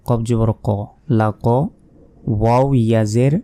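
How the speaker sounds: ordinary talk, nothing marked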